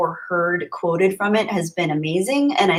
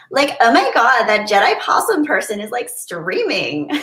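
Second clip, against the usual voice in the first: put-on Valley Girl; 4 semitones higher than usual. Streamer reads a fan's super chat, Valley Girl voice. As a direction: do a ditzy Valley Girl, stretching the last word. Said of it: "read this in a Valley Girl voice".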